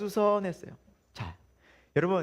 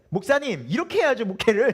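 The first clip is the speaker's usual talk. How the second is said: higher, louder know-it-all telling off; instructing the pastor; 4 semitones higher, 6 dB louder than usual. Retold or imitated. Congregant telling the pastor how to run the church, bossy and raised. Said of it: "교인들이 목사한테 지시할 때".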